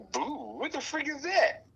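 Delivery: outraged, is she serious; high-pitched disbelief; shocked outrage. Retold or imitated. Mock outrage at being called boo.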